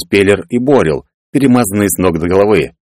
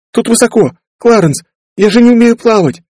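put-on high-pitched, panicky girl's wail; frightened cry; 4 dB louder than usual. A narrator's reading. Girl who cannot swim pleads with Clarence, frightened. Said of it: "закричала Ферби".

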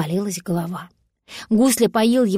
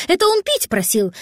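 nervous voice; 5 semitones higher, 3 dB louder than usual. Acted. Voice lying about Glafira.

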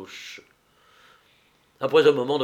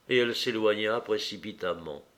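ordinary delivery, nothing marked

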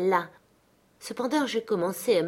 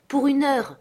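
high, pleading bargain; earnest imploring; 8 semitones higher, 4 dB louder than usual. A narrator's reading. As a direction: implore with a raised pitch. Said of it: "implora-t-il instamment". (imploration pressante)

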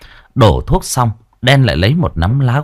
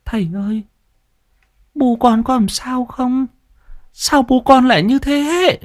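put-on tearful girl. A narrator's reading. Grieving daughter asking the exorcist about her parent, tearful. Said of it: "Cô ấy mếu máo".

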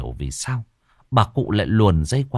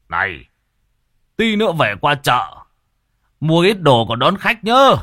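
calling out; 7 semitones higher, 6 dB louder than usual. Acted. Calling out an errand reminder.

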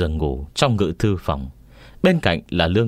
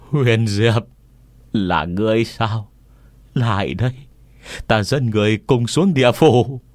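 ghost woman; 3 semitones higher than usual. A narrator's reading. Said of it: "Bà nữ hét lớn một tiếng".

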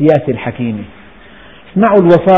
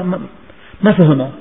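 ordinary delivery

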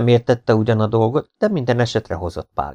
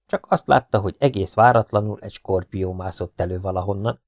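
ordinary delivery

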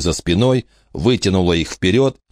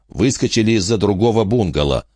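ordinary delivery

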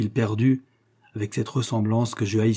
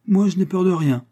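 put-on a boy's proud, higher, louder declaration; proud boast; 8 semitones higher, 4 dB louder than usual. A narrator's reading. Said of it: "me dit-il fièrement".

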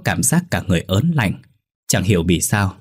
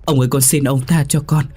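pained pleading cry; 6 semitones higher than usual. A narrator's reading. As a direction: beg for mercy through pain. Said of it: "Con Lục đau quá hét lên".

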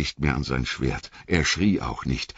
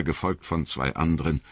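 ordinary delivery, nothing marked

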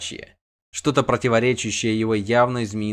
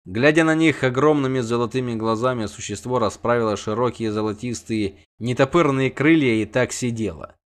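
plain reading voice

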